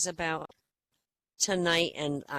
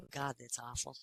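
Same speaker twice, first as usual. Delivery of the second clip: ordinary delivery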